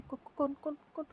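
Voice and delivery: a sudden confused shout on waking; quick stammering alarm; startled awake. Acted. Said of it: "Megha ज़ोर से उठती है".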